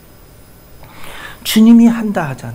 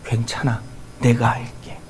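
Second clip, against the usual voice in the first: soft, hushed words of comfort; softly voicing God comforting the weary; gentle reassurance; 6 semitones lower, 7 dB quieter than usual. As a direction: reassure a tired soul, barely above a whisper. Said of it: "이렇게 말씀할 거예요".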